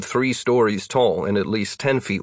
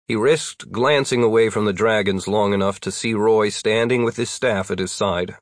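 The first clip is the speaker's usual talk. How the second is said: ordinary delivery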